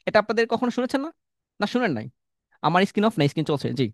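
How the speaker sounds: usual speaking voice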